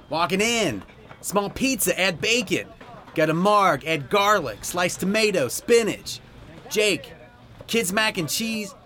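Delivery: high rapid order calls; kitchen callouts. Acted. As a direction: bark the tickets like expo. Expo calling out orders.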